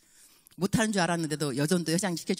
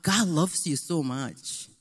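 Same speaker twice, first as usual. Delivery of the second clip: slow earnest; 3 semitones lower, much slower than usual. Retold or imitated. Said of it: "엄마".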